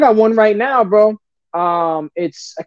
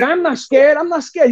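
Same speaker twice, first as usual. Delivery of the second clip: defiant insistence; 7 semitones higher, slower than usual. Retold or imitated. Insisting to the tiger handler.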